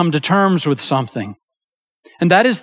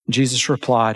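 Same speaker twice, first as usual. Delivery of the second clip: ordinary delivery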